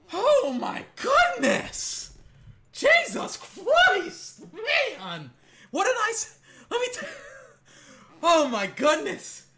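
overjoyed fan yelling at the screen; ecstatic shout. Real, not acted. Fan reacting to another catch.

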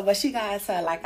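ordinary delivery

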